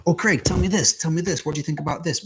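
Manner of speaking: high, keen student voice; mock eager pupils; eager questioning students. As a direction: pester the teacher with questions.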